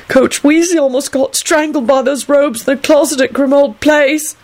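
high, plaintive insistence; stubborn wail. A narrator's reading. Clive arguing from beneath his covers, wailing. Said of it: "Clive wailed stubbornly".

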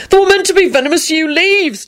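very high defensive protest; frantic holler. A narrator's reading. Neville defending his spell to the boss, frantic. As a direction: holler it back in a panicky squeal. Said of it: "Neville hollered back frantically".

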